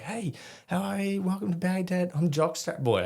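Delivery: high, put-on Steve Irwin-like greeting; hammed-up high-pitched host; animated TV host. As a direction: introduce yourself like an over-eager wildlife presenter. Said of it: "trying to be animated".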